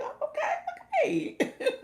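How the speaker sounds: very high, slow, laughing "okay, okay"; excited. Retold or imitated. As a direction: squeal it out, drawn long through laughter. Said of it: "I got excited".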